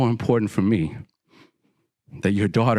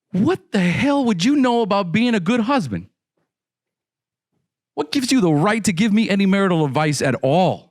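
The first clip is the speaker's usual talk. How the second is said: retold angry snap; 9 semitones higher than usual. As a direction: throw the question back at him, heated. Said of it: "I snapped".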